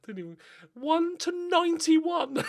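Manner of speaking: giggling at a silly bet; laughing. Real, not acted. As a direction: laugh in disbelief at the odds. Laughing at odds.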